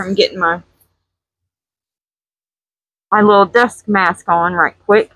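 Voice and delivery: ordinary talk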